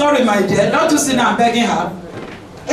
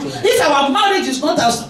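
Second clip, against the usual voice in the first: ordinary talk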